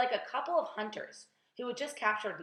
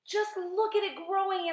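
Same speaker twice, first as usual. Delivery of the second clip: high-pitched, astonished exclamation; excited cry; 10 semitones higher, 4 dB louder than usual. A narrator's reading. Aunt marvels at the growing peach, excited cry. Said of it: "Aunt Spiker cried".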